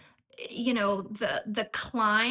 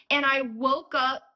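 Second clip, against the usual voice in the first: usual speaking voice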